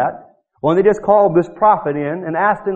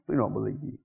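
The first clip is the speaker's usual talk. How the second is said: much lower, softer brush-off; a muttered dismissive reply; mock dismissal; 12 semitones lower, 15 dB quieter than usual. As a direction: wave him away.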